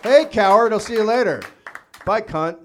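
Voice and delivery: loud, high mocking heckle; heckling from the lawn. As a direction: jeer at them as they leave. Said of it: "I just wanna sit there and go".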